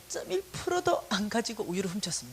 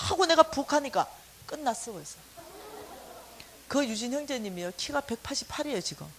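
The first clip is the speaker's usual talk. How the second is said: ordinary talk, nothing marked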